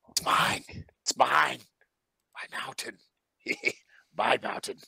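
raspy hissing whisper, wheezing; hissing wheezing creature. Acted. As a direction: hiss it like a desperate Gollum. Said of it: "he's, like, hissing and, like, wheezing at you guys".

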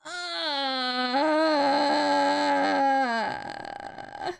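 long, drawn-out groan of dismay; upset groan. Real, not acted. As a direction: let out a long wailing groan.

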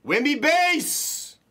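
high, drawn-out hype shout; excited card-break announcer call; hyped call. Real, not acted. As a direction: stretch the name out like a big hit.

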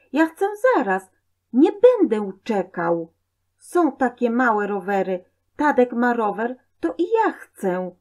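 put-on high, loud childish insistence; building toddler tantrum; demanding child. A narrator's reading. Boy argues with mother for a bicycle, high and insistent.

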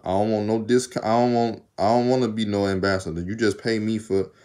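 ordinary talk, nothing marked

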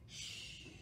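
whispered shush; hush. A narrator's reading. Librarian hushes kids at the story's bedtime ending, whispered. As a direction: hush them like sleeping bats.

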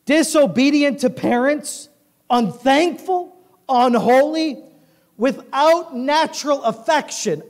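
high, loud list with pauses; emphatic scripture reading. A narrator's reading. Preacher lists end-times sins to congregation, emphatic. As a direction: pound out each item slowly.

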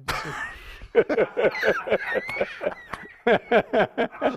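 long, high-pitched laughing fit; real laughing fit. Real, not acted. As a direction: crack up helplessly at the joke. Cracks up at smuggled ashes joke.

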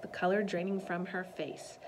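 ordinary delivery

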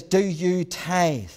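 very slow, each word weighed; missionary grilling his helper, slow and stern; slow stern question. Retold or imitated. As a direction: press him with a drawn-out demand. Missionary pressing young helper.

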